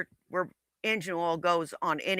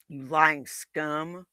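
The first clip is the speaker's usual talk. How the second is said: contemptuous; 5 semitones lower, 4 dB quieter, much slower than usual. Real, not acted. Slow contemptuous insult.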